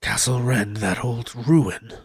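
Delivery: slow, quiet, doubtful soldier; lightly voiced soldier. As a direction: muse on it slowly and low. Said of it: "He says".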